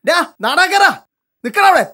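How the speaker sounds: urgent shout to stop; calling out angrily. Acted. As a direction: yell after someone running off. Chasing after someone running away. (ദേഷ്യത്തോടെ വിളിച്ചുകൂവുന്നു)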